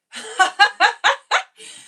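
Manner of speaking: high, loud burst of laughter; big delighted cackle. Real, not acted. Amused by comment she read.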